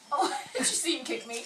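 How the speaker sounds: laughing while talking. Real, not acted. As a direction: laugh through the question. Laughing at baby's kick.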